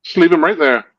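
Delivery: retold brush-off. Telling the host his drunk friend is fine, retold brush-off.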